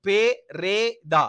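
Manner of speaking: பெரேதா broken slowly into pe, re, daa; syllable by syllable. Shown as a demonstration. Teacher showing pupils the pronunciation, பெரேதா slowly syllable by syllable. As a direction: sound out பெரேதா in three separate beats. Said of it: "உச்சரிப்பு".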